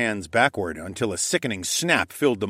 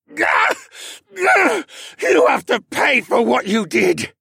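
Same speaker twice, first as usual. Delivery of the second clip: higher, louder, forced out through effort; strained grunt; 7 dB louder than usual. Acted. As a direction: grunt the words out in pain. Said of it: "Cad grunted".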